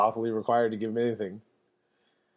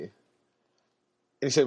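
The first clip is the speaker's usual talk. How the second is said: normal talking voice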